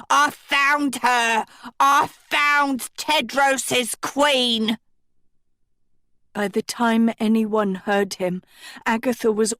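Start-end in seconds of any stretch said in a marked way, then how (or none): 0.1-4.8 slow, high excited croak; croaking old man. A narrator's reading. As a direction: cry out the find, old and toothless. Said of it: "a toothless man croaked".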